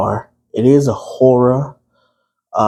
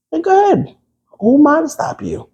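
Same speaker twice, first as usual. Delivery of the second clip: much higher sarcastic go ahead. Acted. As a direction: toss it off sarcastically. Sarcastic permission.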